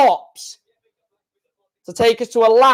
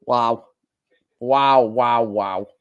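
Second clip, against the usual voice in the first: low, fading wows of disbelief; stunned awe; 11 semitones lower, slower than usual. Real, not acted.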